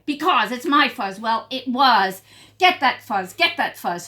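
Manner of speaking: loud squabbling demands; angry quarrel. Acted. Quarrel among prairie dogs.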